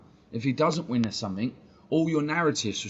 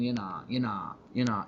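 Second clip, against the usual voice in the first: sneering parody of a grown man's "you nah"; mock the slang, dragging it out; a mild mock of "you nah"; mocking youth slang. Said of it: "start speaking like that".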